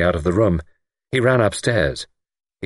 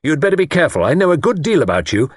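raised, louder warning voice; heated warning; 9 semitones higher, 5 dB louder, faster than usual. A narrator's reading. Man warns the lord to be careful, heated warning. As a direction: warn him, pitched high and forceful. Luke warns his enemy.

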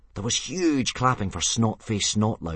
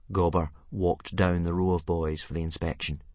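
ordinary delivery

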